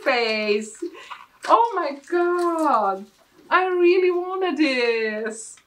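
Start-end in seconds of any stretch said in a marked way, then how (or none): 1.5-5.6 high, loud and drawn-out cry of joy; thrilled shout. Real, not acted. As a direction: let it burst out, slow and high. Thrilled at wished-for product.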